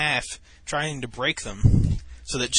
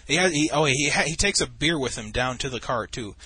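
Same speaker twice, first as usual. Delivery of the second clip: ordinary talk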